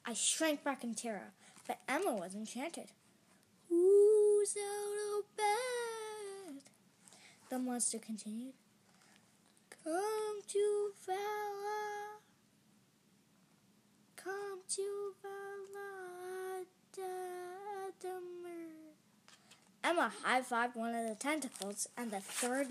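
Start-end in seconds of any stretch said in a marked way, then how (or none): 3.7-6.7 high, loud, very slow ghostly wail; icy monster voice. A narrator's reading. Monster hunting child out of bed, icy wail. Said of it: "an icy voice called". 9.8-18.9 high, very slow, eerie chant; icy monster voice. A narrator's reading. Tentacled monster luring child, icy wail. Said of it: "an icy voice called".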